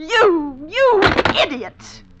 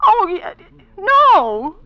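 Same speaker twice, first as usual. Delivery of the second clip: frightened gasp; 7 semitones higher, much slower than usual. Acted. Startled woman alone, phone threat.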